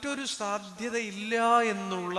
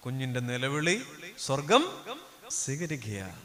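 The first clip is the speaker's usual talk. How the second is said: ordinary delivery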